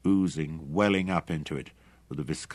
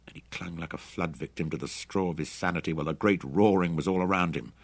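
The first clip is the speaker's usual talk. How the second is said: ordinary delivery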